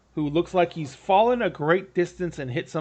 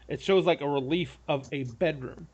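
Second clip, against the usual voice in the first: ordinary delivery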